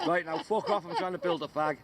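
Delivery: usual speaking voice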